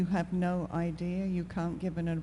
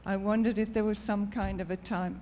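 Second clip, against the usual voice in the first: to someone hard of hearing; 3 semitones higher than usual. Real, not acted. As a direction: project so the back row can hear. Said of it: "Can you speak up".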